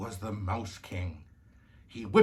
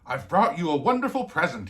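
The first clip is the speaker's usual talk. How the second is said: excited gift-giver; 5 semitones higher, 7 dB louder than usual. A narrator's reading. Godfather presents his gift.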